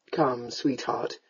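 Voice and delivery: low, slow, soft endearment; gentle coaxing. Acted. Lord coaxes unresponsive sweetheart, gently. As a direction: coax her low and slowly.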